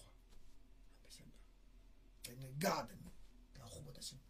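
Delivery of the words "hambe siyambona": breathy hushed whisper, barely voiced. Real, not acted. Speaker hushes mid-talk, whispering. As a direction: drop to a secret whisper. Sudden hush after talk.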